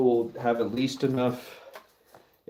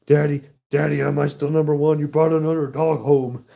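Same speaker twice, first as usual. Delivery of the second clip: needy dog voice; 4 semitones higher, 6 dB louder than usual. Retold or imitated. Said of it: "He's like".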